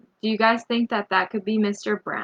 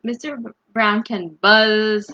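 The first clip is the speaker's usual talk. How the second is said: usual speaking voice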